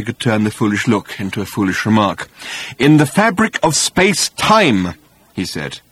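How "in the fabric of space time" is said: slow, higher, insistent explanation; slow, distinct, patient. A narrator's reading. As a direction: press on, enunciating every word. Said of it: "slowly and distinctly and patiently".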